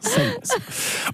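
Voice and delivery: "Silence" crooned up high in a hammed English accent; sing-song English "Silence", way up in pitch; exaggerated English accent. Shown as a demonstration. Host mocking his own accent for co-hosts, high English crooning. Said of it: "l'accent est bancal".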